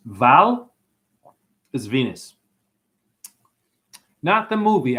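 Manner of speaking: ordinary talk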